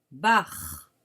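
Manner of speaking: bar repeated in a low clear voice; repeated dictation. Shown as a demonstration. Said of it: "Écrivez la syllabe".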